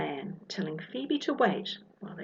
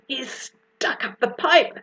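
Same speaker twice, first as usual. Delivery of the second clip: louder, higher call over the noise; yell; 6 semitones higher, 6 dB louder than usual. Acted. Albert calling out over the vacuum, loud. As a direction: shout up over the vacuum roar. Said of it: "Albert yells out".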